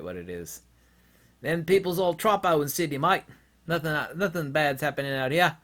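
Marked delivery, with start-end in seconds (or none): 1.4-5.6 higher put-on Australian accent; mock Aussie. Australian calling Sydney people troppo, mock Australian accent. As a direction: shrug it off like a laid-back Aussie. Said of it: "the Australian word for wacko".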